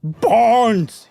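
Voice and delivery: loud, high call down the dark stairs. Acted. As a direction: call out to find a lost friend. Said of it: "he called out".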